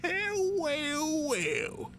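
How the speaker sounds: put-on high, drawn-out cranky old miner; old prospector. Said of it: "crankety old miner".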